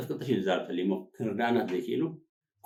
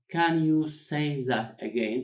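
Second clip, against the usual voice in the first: drawn out, each word set apart, raised pitch; very slow word-by-word model; 6 semitones higher, much slower than usual. Shown as a demonstration. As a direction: stretch every word for learners. Teacher repeats it word by word.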